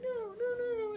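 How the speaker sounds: high, whimpering wail; hammed-up helpless pleading in a high voice; mock despair. Acted despair in the vision.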